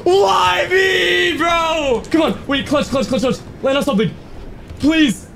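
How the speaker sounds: very high, wailing panic; drawn-out high howls of frustration; despairing scream. Real, not acted. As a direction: wail and plead as you fall. Falling, begging to land.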